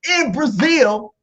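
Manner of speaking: "Brazil" said in an odd, emphatic way; odd pronunciation of Brazil. Real, not acted. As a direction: lean hard on an oddly said "Brazil". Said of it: "Why you saying Brazil like that?".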